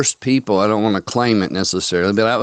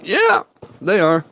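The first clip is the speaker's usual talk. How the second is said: smug told-you-so; 7 semitones higher than usual. Retold or imitated. Smug reply to doubters.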